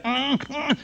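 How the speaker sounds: high-pitched straining grunts; imitated self-biting grunts. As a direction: mimic someone biting himself in a rage. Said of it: "Ele se mordia". (grunhidos agudos imitando quem se morde)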